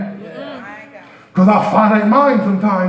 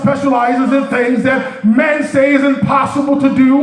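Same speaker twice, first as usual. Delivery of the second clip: full-force preaching; 5 semitones higher than usual. Acted. Preacher lifting the church, full-force preaching. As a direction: preach it out to the congregation, rising and ringing.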